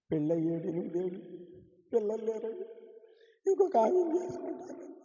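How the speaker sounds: soft, tearful plea rising into a high wail; weeping young wife. Retold or imitated. Pastor imitating a childless young wife, tearful and wailing. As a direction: mimic her crying complaint, quiet then breaking high. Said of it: "అని ఏడుస్తుంది".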